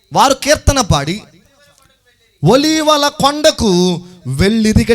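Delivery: high, slow, drawn-out verse reading; scripture proclamation. Real, not acted. (హెచ్చు స్వరంతో నిదానంగా వాక్య పఠనం)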